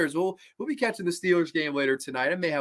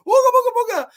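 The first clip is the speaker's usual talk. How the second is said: much higher, louder mock scare; spooky booga; 7 dB louder, much faster than usual. Mimicking Eustace scaring the dog, high and loud. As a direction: squeal booga booga like a prankster in a mask.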